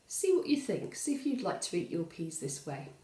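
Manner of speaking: plain reading voice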